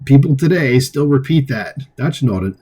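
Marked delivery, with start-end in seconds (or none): none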